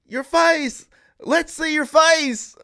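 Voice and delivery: high, loud drawled shout; heckler calling out. Retold or imitated. Audience member demanding the masked speaker unmask, mock shout. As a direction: holler it from the crowd. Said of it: "one guy in the audience is like".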